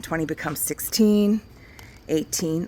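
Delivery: ordinary talk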